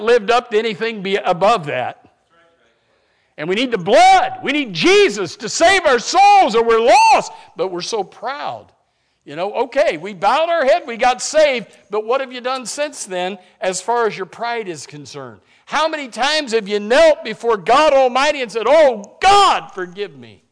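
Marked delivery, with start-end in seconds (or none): 3.4-7.3 louder, higher, driving the point home; preaching at full force. Acted. Preacher pressing the congregation about needing Jesus, full-force preaching. 15.7-20.4 loud, higher pleading preach; full-force preaching. Acted. Preacher urging the church to kneel before God, loud pleading preach.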